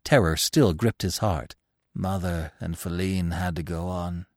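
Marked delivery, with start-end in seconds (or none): 1.9-4.3 soft, resigned answer. A narrator's reading. Exhausted Gobo answers Bambi from the snow, resigned. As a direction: answer quietly, having given up. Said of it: "Gobo answered resignedly".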